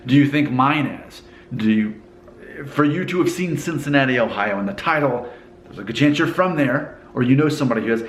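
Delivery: ordinary talk, nothing marked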